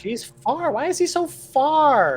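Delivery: high, drawn-out whine about the distance; Cecil's whiny voice. Acted. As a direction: whine it like a frustrated character, lightly voiced. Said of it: "this isn't [name], this is Cecil".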